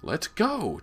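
put-on very high, loud boy's whine to leave; impatient cry. A narrator's reading. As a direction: cry out, fed up with waiting. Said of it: "James cried impatiently".

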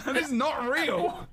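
laughing. Real, not acted. Shocked at fake accent reveal.

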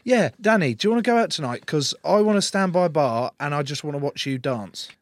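loud, high sarcastic pretend ask; mock invitation. Player pretending to ask his teammate out to watch him dance, loud mockery. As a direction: act out an absurd invitation in ridicule.

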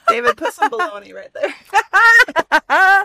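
high, loud laughing shout; laughing call. Real, not acted.

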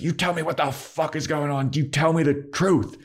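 high-pitched, hammed-up outrage; mock shouted demand. Riley shaking Buffy and demanding answers, mock shouted demand. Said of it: "like, shouting".

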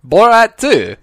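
loud, high shout. Acted. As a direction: blurt it out loudly. Shouted title.

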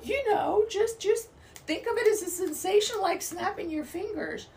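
high, airy dismissive voice; mock breezy advice. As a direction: brush off pain like it's nothing. Mocking glib advice, high voice.

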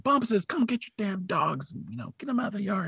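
half-hearted high-pitched angry neighbor yell; cussing dad. Retold or imitated. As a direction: imitate a dad cussing at dogs. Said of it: "cussing at the dogs".

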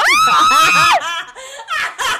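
very high, loud screaming laugh; shrieking laugh. Real, not acted. Co-host shrieking with laughter at the good girl line.

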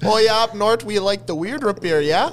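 loud folksy Minnesota voice; northern Minnesota accent; Minnesotan accent. Pretend.

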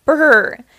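a big shivery brr; shivering brr. A narrator's reading. Purple Monkey covered in snow, shivering brr.

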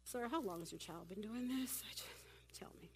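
cool, quiet concern, played up; calm, hushed Jesus. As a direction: ask gently under the breath. Jesus calmly asks the father.

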